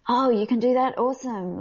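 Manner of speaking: high-pitched, keen reaction; impressed surprise. Retold or imitated. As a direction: imitate a delighted colleague discovering a talent. Acts out impressed industry colleague.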